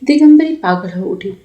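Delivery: usual speaking voice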